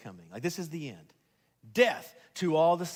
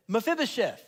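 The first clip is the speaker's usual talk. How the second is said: raised, higher-pitched royal call; emphatic call; 5 semitones higher, 4 dB louder than usual. Acted. King calling Mephibosheth by name, emphatic call. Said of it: "David sort of shouts his name".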